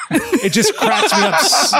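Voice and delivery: high, breaking into laughter; laughing. Real, not acted. Cracking up at his own Rocket bit, laughing. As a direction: laugh through the words.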